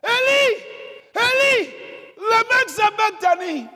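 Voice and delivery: loud, high, drawn-out cry of Eli; anguished shout. Retold or imitated. Preacher re-enacting Jesus calling out on the cross, loud anguished shout. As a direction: cry out the name as if dying on the cross. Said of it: "He shouted".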